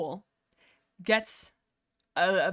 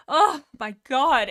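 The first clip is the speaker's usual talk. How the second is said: delighted exclamation; 4 semitones higher, 9 dB louder, slower than usual. Real, not acted. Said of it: "swooning fan".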